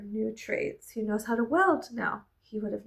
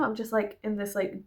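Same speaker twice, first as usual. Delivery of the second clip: ordinary talk, nothing marked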